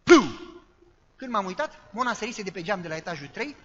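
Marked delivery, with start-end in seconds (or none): 0.0-0.4 imitated thud. Body hits the concrete.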